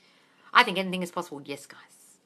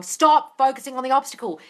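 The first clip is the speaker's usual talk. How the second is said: louder, higher firm order; mock firm command; 7 semitones higher, 11 dB louder than usual. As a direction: bark it out as a stern warning. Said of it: "where I'm going".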